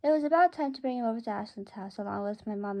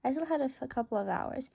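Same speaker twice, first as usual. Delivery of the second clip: ordinary delivery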